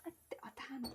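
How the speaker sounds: under-breath whispered curse; whispered aside to self. Real, not acted. Mutters aside during thanks.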